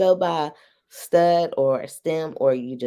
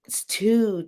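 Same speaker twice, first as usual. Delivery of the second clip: normal talking voice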